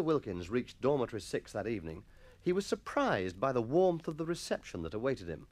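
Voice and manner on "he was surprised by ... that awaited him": plain reading voice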